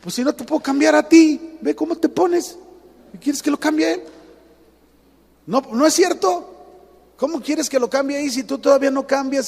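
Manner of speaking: God answers the complainer with a scolding rebuke; God's reply. Pretend. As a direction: chide like a patient but firm father. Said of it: "Dios voltea y te dice".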